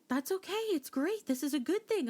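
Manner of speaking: high mock-soothing husband voice; wife mimicking husband's cheery reassurance, high; imitating reassuring husband. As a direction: pitch up to play him calming her down.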